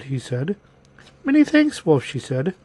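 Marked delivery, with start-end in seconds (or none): 1.2-2.0 put-on high, innocent little-girl voice. A narrator's reading. Girl thanking the wolf, high innocent voice. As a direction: answer politely in a high girlish pitch. Girl answers the wolf's greeting.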